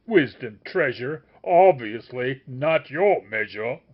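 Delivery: loud, slow furious tirade; raging. A narrator's reading. The master rages at the sage, loud. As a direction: tear into the faker, loud and raging. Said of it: "he keeps on raging".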